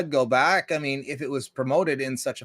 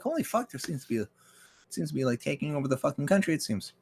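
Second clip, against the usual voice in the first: mock hushed noticing; 8 dB quieter than usual. Quoting people quietly noticing.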